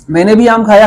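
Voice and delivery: "मैंने भी" ऊँचा और ज़ोरदार; stress on भी. Shown as a demonstration. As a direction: subject को उभारकर "मैंने भी". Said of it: "मैंने पे जोर है". ("मैंने भी" पर ज़ोर)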